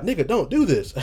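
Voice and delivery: sudden high warning cry in his head; inner warning voice. Retold or imitated. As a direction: blurt it like a gut alarm going off. Inner warning voice, Spidey sense.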